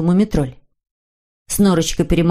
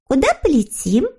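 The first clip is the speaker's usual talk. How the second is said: put-on very high, sweet girlish character voice; eager little girl figure; 7 semitones higher than usual. A narrator's reading. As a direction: agree gladly in a high little voice. Snork Maiden voiced in story. (тоненький девичий голосок)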